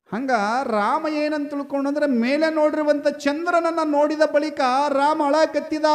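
high, loud, ringing narration; full-force story preaching. Acted. Preacher telling of young Rama seeing moonlight, full-force preaching. Declaims Rama crying for the moon.

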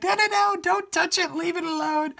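high, loud mock protest from worried viewers; hammed-up, high-pitched frantic objection; pleading viewers. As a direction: act out fans begging in mock alarm. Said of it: "I know some of you are like".

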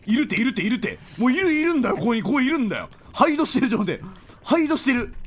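high, loud frantic insisting; panicked excited yelling. Real, not acted. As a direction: yell it out in a panic. Panicking while enemy is near.

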